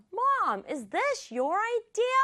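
high, slow questioning of Mom; accusing. Acted. Child arriving in the kitchen to confront Mom, accusing. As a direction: challenge Mom in a high-pitched voice. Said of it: "따지면서 부엌으로 뛰어갔어요".

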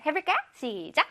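ordinary talk

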